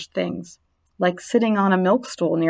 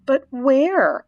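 Wilbur asking; 6 semitones higher than usual. A narrator's reading. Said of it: "asked Wilbur".

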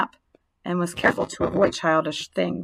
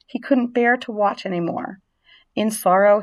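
plain reading voice

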